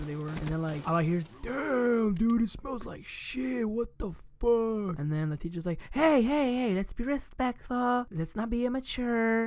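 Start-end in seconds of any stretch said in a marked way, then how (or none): 1.4-5.0 high, slow drawn-out mock disgust; imitated disgusted sixth grader. Sixth graders react to the stink. 5.9-9.4 high, drawn-out teacher voice; imitated scolding teacher.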